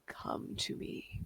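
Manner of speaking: deep rumbling voice from the depths; low, slow, eerie call that fades to a whisper; grinding rumbling rock voice. Acted. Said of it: "deep rumbling voice".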